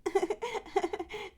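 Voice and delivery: put-on soft, quiet baby giggle; a tiny, soft baby laugh. (बच्चे की धीमी खिलखिलाहट)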